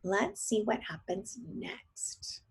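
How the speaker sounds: hushed, slow whisper. Real, not acted. Teacher whispering to the children, hushed. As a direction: lean in and whisper the teaser.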